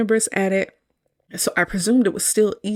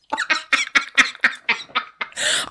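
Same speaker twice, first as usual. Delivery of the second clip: breathy laugh; 6 semitones higher than usual. Real, not acted. Recapping favorite funny scene.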